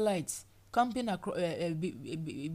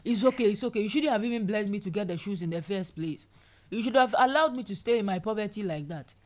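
indignant complaint; 3 semitones higher, 6 dB louder than usual. Acted. Voicing complaining believer.

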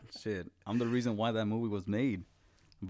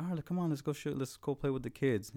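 coaxing teacher; 6 semitones higher than usual. Retold or imitated.

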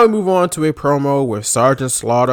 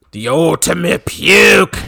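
gravelly drill-sergeant promo growl; Sergeant Slaughter; 3 semitones higher, 4 dB louder, much slower than usual. Retold or imitated. Podcaster quoting the wrestler insulting his rival, gravelly mock promo. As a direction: stretch it out in a mock wrestler rasp. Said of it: "he called the Ultimate Warrior".

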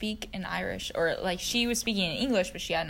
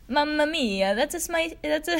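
mock Italian accent, breaking into laughter; a hammed-up Italian accent that falls apart; 6 semitones higher, 3 dB louder than usual. Shown as a demonstration. Showing listeners her Italian accent, cracking up. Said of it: "my Italian accent".

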